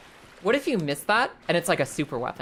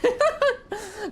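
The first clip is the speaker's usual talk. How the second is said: high laugh. Real, not acted. Laughs at own mistake.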